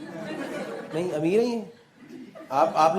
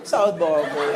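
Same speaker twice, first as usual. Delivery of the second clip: mock posh accent; 5 semitones lower, much slower than usual. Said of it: "You picked it up in South Bombay".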